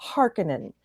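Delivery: Harkonnen with an unstressed, swallowed middle syllable, HAR-kuh-nen; common mispronunciation. Shown as a demonstration. Podcaster quoting how everybody says it, Harkonnen as HAR-kuh-nen. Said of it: "I didn't like how everybody says".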